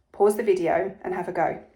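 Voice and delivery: ordinary delivery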